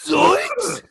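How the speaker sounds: high, loud cartoon dog shout; a loud cartoon Scooby-Doo bark of a line. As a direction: yelp it like Scooby-Doo stuck in Birmingham. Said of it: "I sound like Scooby-Doo".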